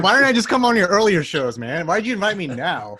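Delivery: loud, high whiny protest; mock complaint. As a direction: whine it like a jilted guest. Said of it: "He's like".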